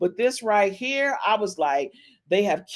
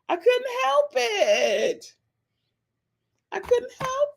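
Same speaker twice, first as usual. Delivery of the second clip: high whiny. Acted. High slow mock whine.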